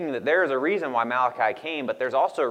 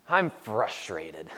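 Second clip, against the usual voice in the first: imitating toddler daughter; 5 semitones lower, 5 dB quieter, much slower than usual. Dad voicing toddler daughter.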